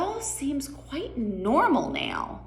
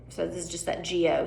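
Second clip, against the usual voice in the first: usual speaking voice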